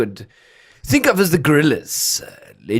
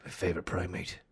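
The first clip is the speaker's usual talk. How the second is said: low, much softer, near-whispered threat; Liam Neeson impression; 7 semitones lower, 17 dB quieter, faster than usual. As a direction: read it like a quiet menacing hero. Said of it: "That's Liam Neeson".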